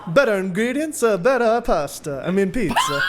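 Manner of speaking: loud, high Italian accent. Shown as a demonstration. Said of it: "Can you do an Italian accent?".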